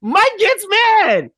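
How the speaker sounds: high, loud, drawn-out exclamation; emphatic exclamation. Real, not acted. Host announcing the big blowup, emphatic high holler. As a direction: stretch it out loudly, way up high. Host hammers the key plot point.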